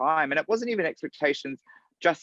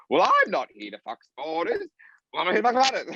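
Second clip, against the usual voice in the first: mock rehearsal flub; 8 semitones higher, 3 dB louder than usual. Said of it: "It's just like".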